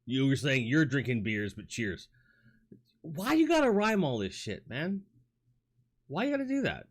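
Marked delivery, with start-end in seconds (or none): 3.0-6.8 high whiny voice pleading with a chatter; mock whiny complaint. Streamer whining at a rhyming chatter, high and mock-pleading. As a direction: whine at him like a put-upon friend.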